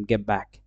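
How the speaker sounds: "গেট ব্যাক" as geb-back, low; assimilated form. Shown as a demonstration. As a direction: drop the t of "গেট" into a b. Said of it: "টিটা বাদ দিয়ে এটাকে বি".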